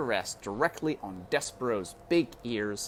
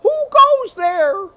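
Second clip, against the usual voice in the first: high, loud, slow shout of the cook; 17 dB louder, slower than usual. A narrator's reading. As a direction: bellow into the dark kitchen. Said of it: "and shouted".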